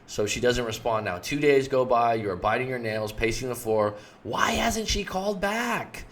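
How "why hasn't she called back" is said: high, slow fretful whine; anxious worrying. Acted. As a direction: fret aloud while pacing the floor. Biting nails, pacing the floor.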